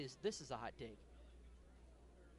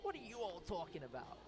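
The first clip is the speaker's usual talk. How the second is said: mock Maine local, in a light accent; New England accent; 3 semitones higher than usual. Teasing how Mainers react to the chilly booth, light accent. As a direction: put on a touch of a Maine accent. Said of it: "the little accent there for a minute".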